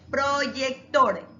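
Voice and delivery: "proyector" stretched into pro-yec-tor, stress on "tor"; slow teacher's sounding-out of "proyector", marking final "tor"; syllable-by-syllable demo. Shown as a demonstration. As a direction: say "proyector" very slowly, syllable after syllable. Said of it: "La sílaba tónica está al último".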